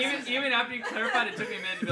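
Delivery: laughing while telling it; giggling through the words. Real, not acted. Laughing at own confusion.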